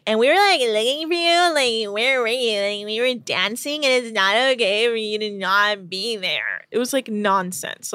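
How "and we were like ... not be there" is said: put-on slurred, whiny drunk rambling; wasted Lauren. Drunk crew member rambling at Nico about dancing, slurred mock-drunk voice. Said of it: "Lauren is wasted and talking to Nika".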